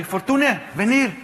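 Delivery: high called-out Spanish summons; coach calling out. Retold or imitated. Mimicking the manager calling him to warm up, high and called out. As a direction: shout the name across the pitch like a coach. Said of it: "the manager called me".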